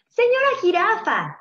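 high, loud call as a little chick; eager high-pitched hail. A narrator's reading. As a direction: sing out the name to get attention. Chick calls to the giraffe.

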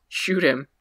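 ordinary talk, nothing marked

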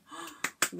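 put-on a soft, breathy gasp for the children; little whispered gasp; playful mock gasp. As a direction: gasp in pretend surprise. Surprise at end of rhyme.

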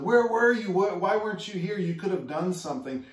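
high, loud pleading reproach; reproachful cry. Retold or imitated. Grieving sisters reproaching Jesus, loud and high. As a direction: cry out where were you at him.

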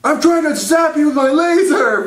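high-pitched indignant reply; exasperated toy hero. Acted. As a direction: explain it as a frustrated toy spaceman. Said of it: "And it's like".

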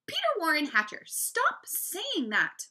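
high, loud maternal scolding; hollering. A narrator's reading. Mom scolds Peter for his claim, hollering. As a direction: holler the full name as a reprimand. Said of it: "Mom hollered".

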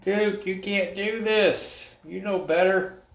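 high, slow dog-scolding voice. Real, not acted. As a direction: tell off the pup in a sing-song.

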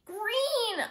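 put-on high, drawn-out child's wail. A narrator's reading. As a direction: wail it in dismay. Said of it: "Kirsty wailed".

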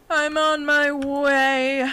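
loud, high, long drawn-out call. Pretend. Player calling to the friend they left, drawn-out holler. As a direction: stretch each word out loudly on a held note.

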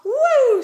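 high, loud "woo"; playful whoop. Real, not acted. Owner doing a flippy flop with the cat, playful whoop. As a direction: whoop as if flipping a pet over.

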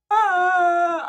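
high, loud drawn-out "ah"; imitated baby yell. Baby yelling at the one watching him, imitated. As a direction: squeal out like a demanding baby. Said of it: "you gotta yell".

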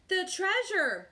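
high, loud, slow boom; booming crew. A narrator's reading. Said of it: "boomed the crew".

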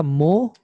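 मोहम्मद said carefully with a doubled म; teacher demonstrating how मोहम्मद is pronounced; careful pronunciation. Shown as a demonstration. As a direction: model मोहम्मद clearly for students. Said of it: "मोहम्मद लिखने के लिए".

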